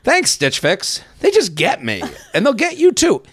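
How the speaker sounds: sarcastic reading of the mandatory ad line; mocking the scripted line. Shown as a demonstration. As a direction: ham up the forced slogan with giggles. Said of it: "and then I have to read".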